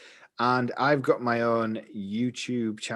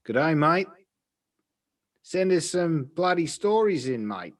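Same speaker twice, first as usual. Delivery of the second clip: higher, hammed-up Aussie accent; terrible Australian accent; 6 semitones higher, slower than usual. Podcast host begging the Australian listener for stories, terrible Australian accent. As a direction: ham up a bad Australian accent, slowing on the request. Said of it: "That was my terrible Australian accent".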